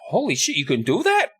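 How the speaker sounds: put-on high, astonished boy's voice; amazed apprentice. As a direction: marvel like an impressed kid. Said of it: "And Nathan's like".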